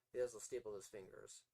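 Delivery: usual speaking voice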